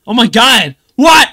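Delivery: loud, high yelled disbelief; iShowSpeed. Retold or imitated. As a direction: shout it like an over-the-top streamer. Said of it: "the Speed talks".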